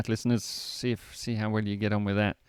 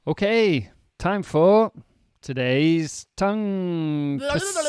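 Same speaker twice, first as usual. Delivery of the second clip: higher, louder, slow announcement; announcer voice; 8 semitones higher, 5 dB louder, much slower than usual. Acted. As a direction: announce the segment with fanfare. Announcing the segment.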